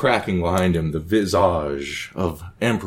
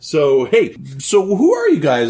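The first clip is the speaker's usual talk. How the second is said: much higher, giddy manic emperor chatter; Caligula, coked-out host; 10 semitones higher, faster than usual. Acted. Caligula fawns over the guests on his boat, high and manic. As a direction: play a wired, delighted emperor.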